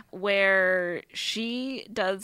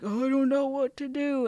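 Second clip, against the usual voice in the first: mock helpless wail, pitched high; hammed-up dithering soldier at a loss; panicked Gorman; 7 semitones higher than usual. Retelling how the officer froze after the alien attack, mock panic. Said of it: "Gorman is like".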